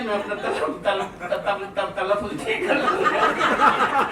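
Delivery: soft, halting stammer on तलफ़ुज़; stumbling over a word. Retold or imitated. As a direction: act out tripping on तल-, तल- before getting तलफ़ुज़ out. तलफ़ुज़ पर अटकने का अभिनय. (तलफ़ुज़ पर अटकते हुए, धीमे हकलाना)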